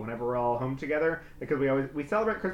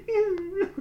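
much higher squeaky ew; mock disgust. Grossed out at the image of himself on mom's lap.

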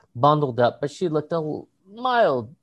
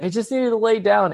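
higher-pitched tipsy teacher imitation; intoxicated mock voice, pitched up; drunk and high; 7 semitones higher than usual. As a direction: mimic a woozy, high colleague. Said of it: "sounded, like, fully drunk and high".

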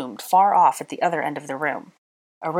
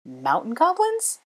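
slow, high rising question; incredulous repeat; 8 semitones higher, slower than usual. A narrator's reading. Hermione repeating Draco's answer, incredulous. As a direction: echo it in disbelief, pitch climbing. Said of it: "Hermione repeated".

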